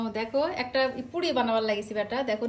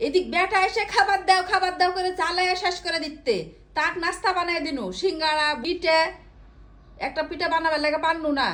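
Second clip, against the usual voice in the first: real nagging rant; 4 semitones higher than usual. Real, not acted. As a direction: scold a lazy husband, voice raised. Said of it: "তুমি এত চিল্লাচ্ছ কেন". (চড়া গলায় বকাঝকা)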